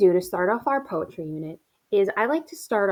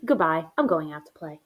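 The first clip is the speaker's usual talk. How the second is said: sudden low, dismissive goodbye; breezy mock farewell; 4 semitones lower than usual. Suddenly recovered child leaving to play, breezy. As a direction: drop the act and sign off. Poem child drops excuses, leaves.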